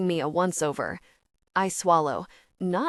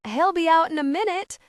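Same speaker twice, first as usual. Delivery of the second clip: bright, much higher, louder announcement; cheerful; 9 semitones higher, 5 dB louder than usual. A narrator's reading. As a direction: sell it with forced cheer. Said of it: "I announce cheerfully".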